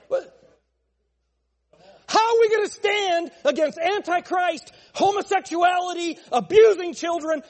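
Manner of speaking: high, full-force pulpit roar; loud, high, impassioned holler; mad preaching shout. Real, not acted. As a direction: cry out in exasperation to the whole church. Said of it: "Man, he was really mad today".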